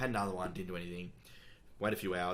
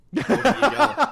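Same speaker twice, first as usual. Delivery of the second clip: squeaky, much higher and louder teasing echo; mock hype echo; 14 dB louder, slower than usual. Podcast cohost teasing his friend's huge goal, squealing.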